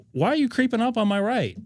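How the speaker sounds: high, indignant mock voice of the other driver. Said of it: "he's like".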